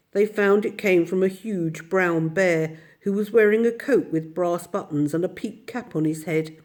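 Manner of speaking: ordinary delivery, nothing marked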